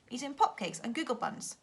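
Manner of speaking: ordinary delivery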